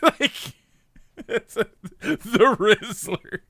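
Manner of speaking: real laughter breaking into the words; laughing. Real, not acted. Streamer amused by his own slang joke, laughing. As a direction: crack up while getting the words out.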